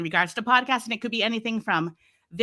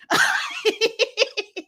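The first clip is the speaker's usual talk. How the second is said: laugh; 13 semitones higher than usual. Real, not acted. Laughs at own story.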